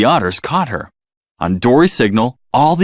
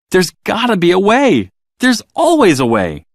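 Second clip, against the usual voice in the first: higher, insistent and louder; determined; 9 semitones higher, 4 dB louder than usual. Acted. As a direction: refuse to give up, rallying. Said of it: "she said with determination".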